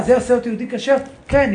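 ordinary talk, nothing marked

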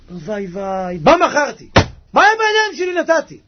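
high, loud wail of regret; a seller's pained lament, voice jumping up; anguished regret. Acted. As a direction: cry out as if you threw away a treasure. Seller regrets selling treasure land.

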